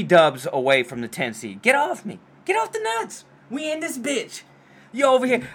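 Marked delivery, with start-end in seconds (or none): 1.6-5.5 hyped exclamation. Real, not acted. Hyped about playoff chances.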